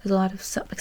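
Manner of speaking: normal talking voice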